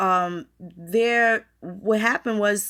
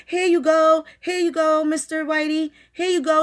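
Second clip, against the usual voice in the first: mocking servile offer; 8 semitones higher, 7 dB louder than usual. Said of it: "giving it to these".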